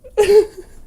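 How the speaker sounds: loud, high squealing laugh bursting out. Real, not acted. Losing it over the fanfic, a loud high laugh. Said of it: "It's the silent chuckles".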